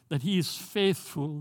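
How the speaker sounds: ordinary delivery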